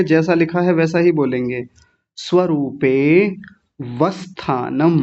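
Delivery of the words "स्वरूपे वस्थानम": स्वरूपे वस्थानम very slowly, without the अ; correct pronunciation. Shown as a demonstration. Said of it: "बिल्कुल ऐसे ही बोलना है".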